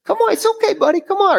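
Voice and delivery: put-on high cooing voice to a baby; sweet high baby-talk coaxing. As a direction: coax like talking to a toddler. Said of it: "You ever talk to your baby?".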